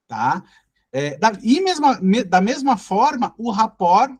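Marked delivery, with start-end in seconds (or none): none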